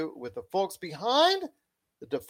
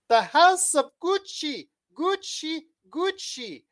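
put-on high, loud hammy Italian accent; bad Italian accent; 12 semitones higher, 6 dB louder, slower than usual. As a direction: overdo the Italian accent on Gucci. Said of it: "the worst Italian accent I could do".

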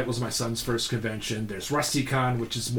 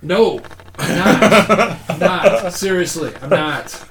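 laughing denial; 4 semitones higher, 8 dB louder, slower than usual. Retold or imitated. Denying being a racist, retold.

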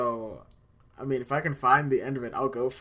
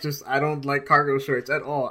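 ordinary talk, nothing marked

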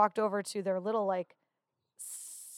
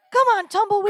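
high, loud race-track cheering; Irv Blitzer cheering; 12 semitones higher, 10 dB louder than usual. Retold or imitated. Wife imitating her husband cheering a bet, excited yell. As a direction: urge the horse on at full voice. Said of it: "he was cheering".

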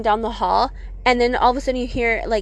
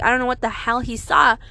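ordinary delivery, nothing marked

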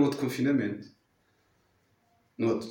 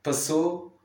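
ordinary delivery

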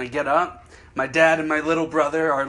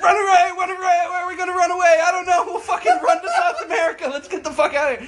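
higher, louder laughing panic; mock panic; 13 semitones higher, 3 dB louder than usual. Golfers panicking after hitting an old man, mock panic and higher. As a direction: scramble to flee. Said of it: "It's like".

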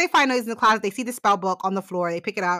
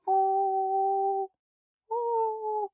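high mock pity; 10 semitones higher, 5 dB quieter than usual. Reacting to pig's death.

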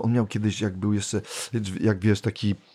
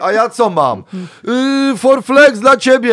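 much higher and louder, stretched; mock diva voice; 13 semitones higher, 8 dB louder, slower than usual. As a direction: proclaim the pills like a stage gift. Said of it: "a tam drugi".

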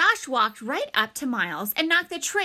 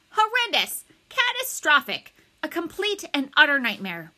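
loud, high, emphatic complaint; exasperated ranting; 6 semitones higher, 5 dB louder than usual. A narrator's reading. Principal telling his wife about his day, exasperated. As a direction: pile up each word with mounting dismay.

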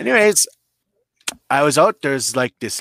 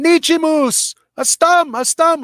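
high, loud call, stretched out slow; retold yelling; 5 dB louder than usual. Man at his truck hollering to his wife, high calls. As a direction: holler the name across the lot, waving. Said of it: "he's going".

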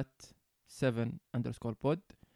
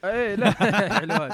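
laughing out loud, louder and higher; 6 semitones higher, 8 dB louder than usual. Real, not acted. Laughing at his friend's teasing remark. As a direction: breaks into real laughter at the jab. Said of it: "أنت ما شفت حد".

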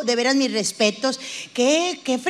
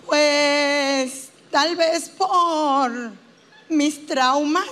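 very slow, drawn-out whine; hammed-up therapy patient, haltingly dragging every phrase; self-pitying patient; much slower than usual. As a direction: stretch the last vowels into a near sing-song moan. Acting the patient again.

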